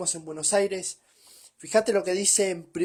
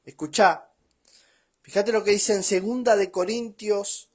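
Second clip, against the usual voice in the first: normal talking voice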